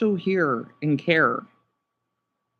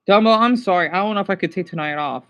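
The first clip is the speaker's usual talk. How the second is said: ordinary talk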